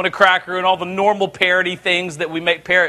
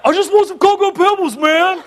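higher put-on pleading kid voice; whiny kid; 9 semitones higher than usual. Son begging dad for cereal, mock whiny. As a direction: plead like a boy denied cereal. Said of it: "And he's like".

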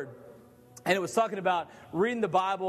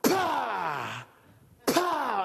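mock slow-motion punch sounds; punch noises; 3 semitones higher than usual. Kid uppercutting the big guy, comic punch sounds. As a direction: punch the air with your voice.

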